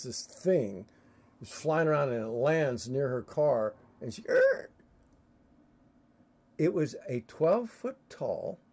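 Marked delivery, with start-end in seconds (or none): 4.3-4.7 high squeaky yelp; startled yelp. Retold or imitated. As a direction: yelp in shock. Friend startled by creature.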